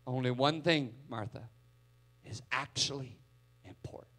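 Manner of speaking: soft, trailing off to a whisper; fading to a whisper. Acted. Preacher voicing Jesus's point to Martha, fading to a whisper. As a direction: let the last word be breathed. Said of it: "tender close, fading to whisper".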